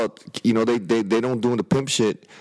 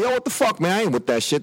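retold indignant protest, pitched up; kinda yelling; 7 semitones higher than usual. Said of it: "I was kinda yelling at it".

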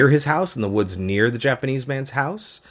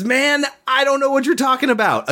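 squeaky high-pitched protest, much higher; high mock denial; 3 dB louder than usual. Peter denying Jesus to the crowd, squeaky high voice. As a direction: play the panicked disciple in a high squeak. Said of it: "that's literally what he says".

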